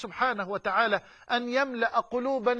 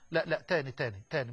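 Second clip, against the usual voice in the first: usual speaking voice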